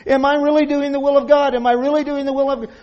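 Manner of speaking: high-pitched imitation of anxious fretting; hammed-up worrier, racing as it repeats; mock fretting. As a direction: fret it aloud, rushing the repeat. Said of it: "fretting about every little thing".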